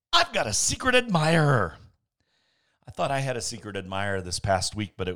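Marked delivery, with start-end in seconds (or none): 0.1-1.8 louder, higher, delighted cry; exclaimed. Acted. Lonely Mister Hatch exclaiming about his admirer, delighted. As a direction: burst out with happy surprise.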